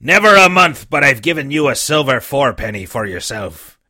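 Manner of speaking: put-on a weak old seaman coaxing the boy; dying buccaneer begging the boy for rum; old sick sea captain. The old captain voiced in reading.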